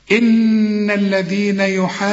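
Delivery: slow chanted recitation; tajweed recitation. Shown as a demonstration. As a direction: stretch the madd before the shadda. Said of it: "في مد وبعده حرف مشدد".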